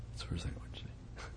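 fast, breathy aside in a whisper; hushed whisper. Real, not acted. Preacher confiding to the congregation, fast whisper. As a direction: drop to a quick whisper.

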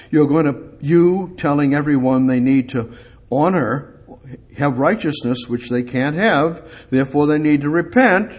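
ordinary talk, nothing marked

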